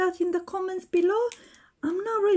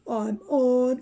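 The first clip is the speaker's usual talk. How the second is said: much lower, gruff tough-guy boasting; toy Batman voice; 5 semitones lower than usual. Pretend. Batman taunting the other toys, deep boastful voice. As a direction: play the bully hero claiming his spot.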